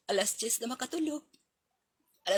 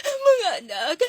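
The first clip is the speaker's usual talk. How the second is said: joyful call; 6 semitones higher, 12 dB louder, slower than usual. Acted. Greeting children on return.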